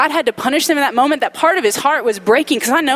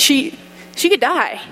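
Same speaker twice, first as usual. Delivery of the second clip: choked up; 4 semitones higher, slower than usual. Real, not acted. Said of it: "I can't even say it".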